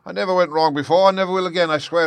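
very high, loud, frantic pleading; panicked wail climbing in pitch; terrified shrieking. A narrator's reading. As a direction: beg desperately for mercy. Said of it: "he shrieked".

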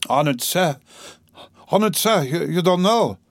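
mild, agitated cry rising in pitch; understated frantic interruption; mock scream. A narrator's reading. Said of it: "screamed Katerina Ivanovna".

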